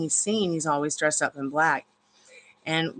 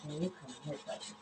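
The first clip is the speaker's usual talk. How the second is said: soft whisper to the dog; whispered coaxing; 4 semitones lower, 19 dB quieter, faster than usual. Real, not acted. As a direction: hush it out.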